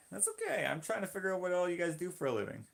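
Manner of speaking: very high, quick put-on voice; high-pitched reassurance. Streamer reassuring viewers, high quick voice. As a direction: pitch it way up and rattle it off.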